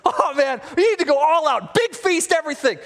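high, eager pitch, piling it on; gushing excitement. Acted. Haman excitedly pitches the king a celebration, gushing. Haman gushes his honour plan.

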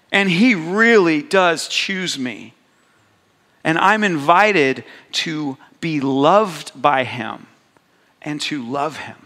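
rising, emphatic preaching of being chosen; impassioned sermon voice; heartfelt sermon. Real, not acted. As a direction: preach it with swelling conviction. Emotional opening testimony.

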